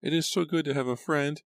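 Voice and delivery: put-on high-pitched, earnest child; pleading little girl. A narrator's reading. Abigail appeals to her father about friendship, pleading. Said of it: "Tears were forming in her eyes".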